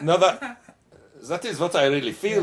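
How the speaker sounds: hammed-up German accent butting into the interview. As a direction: play Helmut, a jolly Bavarian bandleader, with a German accent. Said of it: "Yeah, Helmut gets in the way".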